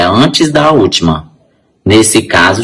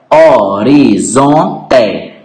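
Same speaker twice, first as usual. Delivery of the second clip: "horizonte" slowly split as ho-ri-zon-te; syllable by syllable; much slower than usual. Shown as a demonstration. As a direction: count out four separate syllables in "horizonte". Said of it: "quatro sílabas". ("horizonte" pausado, sílaba por sílaba)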